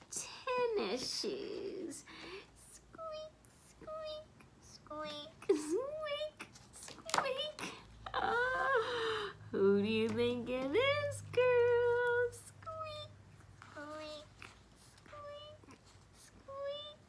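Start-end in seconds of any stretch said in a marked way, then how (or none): 2.7-7.6 tiny high squeaks, very soft; soft high squeak noises; squeaky shoe sounds. Acted. Imitating squeaky tennis shoes. 12.5-17.0 tiny high squeaks, very soft; soft high squeak noises; squeaky shoe sounds. Acted. Imitating squeaky tennis shoes.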